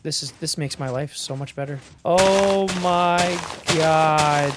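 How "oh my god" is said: very slow, louder drawn-out joy; ecstatic. Real, not acted. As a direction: gush with overjoyed relief. Overjoyed at fixed machine.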